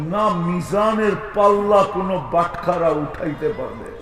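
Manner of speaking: normal talking voice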